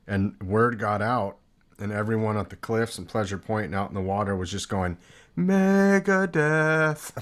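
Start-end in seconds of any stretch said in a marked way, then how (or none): 5.4-7.1 drawn-out high sing-song taunt; mock taunt. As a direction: drag it out like a jeering crowd. Surfers taunting [name].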